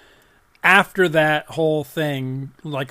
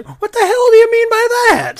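much higher and louder, pretend outrage; mock indignant outburst; 13 dB louder than usual. Andy writing back, mock indignant yell. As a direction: fire back as the offended Brit. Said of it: "Now he's gonna write me and say".

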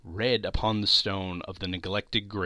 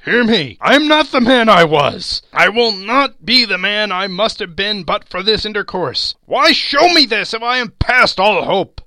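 loud, high, anguished pleading; frantic raised-voice entreaty; desperate plea; 10 semitones higher, 10 dB louder than usual. A narrator's reading. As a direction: plead at full voice for your life. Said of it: "tight clutching at his robes".

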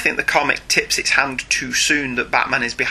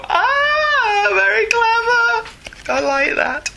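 surprised; much slower than usual. Retold or imitated. Imitating reader's sudden realisation.

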